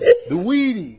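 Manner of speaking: loud, high laughing answer. Real, not acted. Laughing while explaining the joke to girlfriend. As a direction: laugh out the code word. Laughing over cat food code name.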